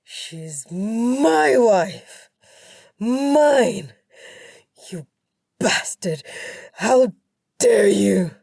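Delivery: full-force possessive roar; very loud, drawn-out husband's rage; enraged yelling. A narrator's reading. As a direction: yell it at a rival in fury. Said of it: "My husband kept yelling".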